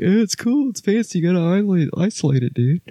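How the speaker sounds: high, goofy mock producer voice; squeaky imitation of a know-it-all. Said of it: "'cause it was like".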